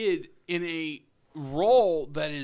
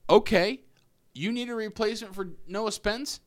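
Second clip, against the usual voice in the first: cocky player; 6 semitones higher than usual. Retold or imitated. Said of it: "Jamal Marcus voiced as confident player".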